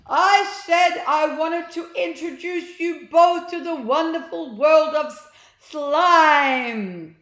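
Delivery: huge, slow, high shouted call. A narrator's reading. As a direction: yell it to the roof with everything. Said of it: "He had to shout louder".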